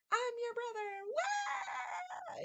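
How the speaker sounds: very high joyful shout; triumphant reveal. Retold or imitated. Joseph revealing himself to his brothers, a high joyful shout. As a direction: cry it out sky-high. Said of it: "he threw the guards out and said".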